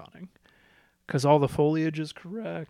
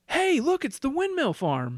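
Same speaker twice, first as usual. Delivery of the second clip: daughter voice; 12 semitones higher than usual. Retold or imitated. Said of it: "my daughter, goes".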